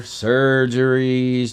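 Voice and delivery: surgeries stretched very slow; drawn-out word. Retold or imitated. Retelling the form to the audience, slow drawn-out surgeries. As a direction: drag out surgeries like a long list.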